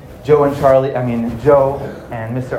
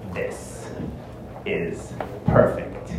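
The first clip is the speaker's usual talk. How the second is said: very slow, low whisper with pauses; hushed, drawn-out gloating to himself; awed, scheming whisper; 7 semitones lower, 9 dB quieter, much slower than usual. Acted. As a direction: whisper each word with secret satisfaction. Charlie secretly admires the room.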